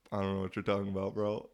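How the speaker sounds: quick, offhand denial; playing dumb. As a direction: brush it off fast, feigning ignorance. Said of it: "I'd be like".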